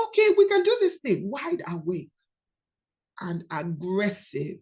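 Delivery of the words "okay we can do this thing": eager. Acted. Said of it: "you're like".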